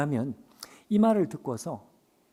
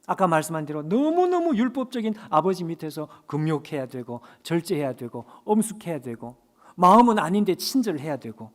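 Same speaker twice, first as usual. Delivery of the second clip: loud, raised fervent sermon; impassioned preaching; 5 semitones higher, 6 dB louder than usual. Acted. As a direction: build the crowd up with fervor. Preaching at full force. (열정적으로 목소리를 높이는 설교)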